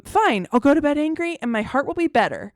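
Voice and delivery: imitated defiant kid, high and loud; upset child. Daughter retorting to dad at bedtime, imitated upset child. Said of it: "she was beside herself".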